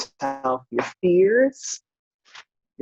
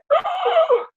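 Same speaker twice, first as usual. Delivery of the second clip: sharp gasp; 3 dB louder than usual. Retold or imitated. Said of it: "And I just went".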